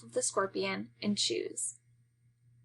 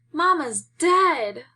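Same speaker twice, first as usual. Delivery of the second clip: put-on slow, higher, louder childish wail; 6 semitones higher, 6 dB louder, much slower than usual. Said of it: "Buddy wails".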